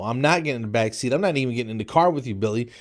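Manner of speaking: usual speaking voice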